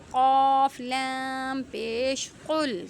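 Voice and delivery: قاف لام پیش قل spelled slowly letter by letter; spelling out a letter. Shown as a demonstration. Teacher spelling a word for students, slow and deliberate. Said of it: "کیسے پڑھیں گے؟".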